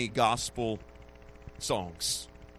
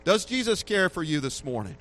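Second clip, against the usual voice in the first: ordinary talk, nothing marked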